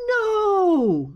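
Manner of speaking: long high wailed no; drawn-out, falling moan of dismay. A narrator's reading. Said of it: "moaned Fox".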